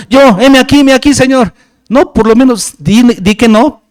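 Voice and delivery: fervent sermon climax; preaching at full force. Acted. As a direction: press the congregation hard from the pulpit.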